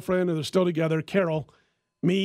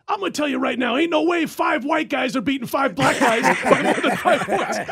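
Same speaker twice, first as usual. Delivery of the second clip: higher, emphatic mock radio pronouncement; imitating I M Johnson; 7 semitones higher, 4 dB louder than usual. Handicapper giving his pick on air, imitated emphatic outburst. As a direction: declare it loudly, breaking into laughter. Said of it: "He goes".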